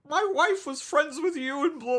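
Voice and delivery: high, whiny imitation of a crying man; mock a tearful complaining husband; put-on weepy falsetto. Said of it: "it was, like, crying. He's like".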